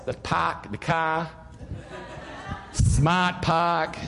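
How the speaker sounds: slow, drawn-out Boston "park the car"; mock Boston accent. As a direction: tease him in a put-on Boston accent. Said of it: "I was teasing him the other day".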